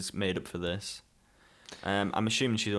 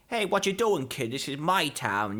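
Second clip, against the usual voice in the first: tough mob boss; 6 semitones higher, slower than usual. Retold or imitated. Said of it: "they kinda play him off as like".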